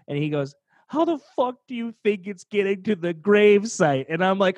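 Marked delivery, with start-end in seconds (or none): 0.9-4.1 louder, higher incredulous snap; mock exasperated brother. Brother scoffs at him in the car, louder and higher. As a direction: brother scoffing at a dumb assumption. Brother snaps back at funeral.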